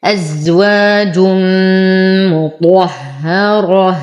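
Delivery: very slow "أزواج مطهرة", tanween merged into a long hummed m; إدغام بغنة. Shown as a demonstration. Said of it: "فالحكم إدغام بغنة". ("أزواج مطهرة" ببطء مع غنة ووقف بالهاء)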